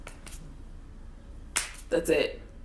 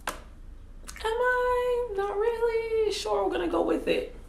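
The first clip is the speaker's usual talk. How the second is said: very high, drawn-out singsong answer; mock nervous squeak; slower than usual. Skydiver answering the instructor before the jump, mock nervous squeak. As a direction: squeal it, half-singing, in mock hesitation.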